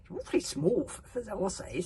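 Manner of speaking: disdainful and dismissive; sniffy. A narrator's reading. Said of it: "sniffed the chief berserk".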